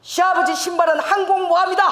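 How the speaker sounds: 연대장처럼 큰 소리로 호령하듯; father-in-law's command. Retold or imitated. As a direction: drill it into her like a regiment commander. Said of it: "이러는 거예요".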